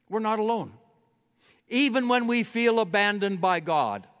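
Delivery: rising, insistent preacher's build; preaching at full force. Acted. Pastor to his congregation, preaching with mounting force. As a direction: drive each refrain home with growing fervour.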